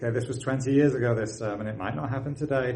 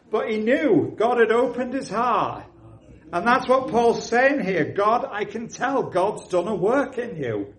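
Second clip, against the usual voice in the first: high, loud preaching; fervent preaching; 8 semitones higher, 3 dB louder than usual. Acted. Preacher assuring his church of God's work, impassioned preaching. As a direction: preach it with fire to the congregation.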